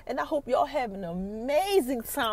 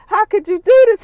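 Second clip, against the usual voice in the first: much higher, loud betrayed cry; anguished yell; 8 semitones higher, 16 dB louder than usual. Retold or imitated. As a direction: shout the hurt at her. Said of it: "Ghost is like".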